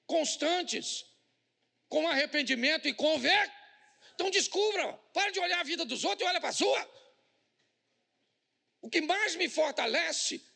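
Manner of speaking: high, loud, rising sermon climax; fiery preaching. Acted. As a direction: preach it with full force to the church. Preaching at full force.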